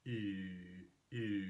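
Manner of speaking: "ee" said with lips rounded as for oo; long "ee" through rounded lips, like German ü; rounded front vowel. Shown as a demonstration. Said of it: "with rounded lips like you're making oo".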